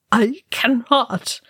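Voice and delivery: whispered, slow, choking grief; choked whisper. A narrator's reading. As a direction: barely get the words out. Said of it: "Balin choked".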